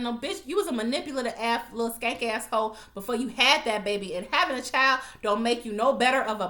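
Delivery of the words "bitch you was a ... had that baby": ordinary talk, nothing marked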